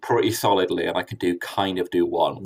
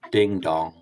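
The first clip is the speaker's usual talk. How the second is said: doorbell voice; 4 semitones lower than usual. Pretend. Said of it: "I'll give you a doorbell now".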